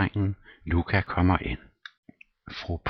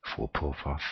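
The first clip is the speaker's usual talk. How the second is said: plain reading voice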